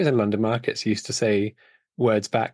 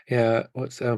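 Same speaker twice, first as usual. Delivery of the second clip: normal talking voice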